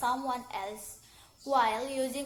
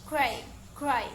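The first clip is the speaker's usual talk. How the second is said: "gray" said twice in an American accent; American pronunciation of "gray"; 4 dB louder than usual. Shown as a demonstration. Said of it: "American accent said".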